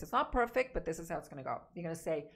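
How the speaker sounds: usual speaking voice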